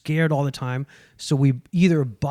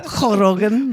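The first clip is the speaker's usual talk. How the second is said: higher "Ho Rogan" with a throaty Spanish jota; hammed-up Spanish accent through a laugh; exaggerated Spanish accent; 6 semitones higher, 4 dB louder, slower than usual. Shown as a demonstration. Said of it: "with a Spanish accent".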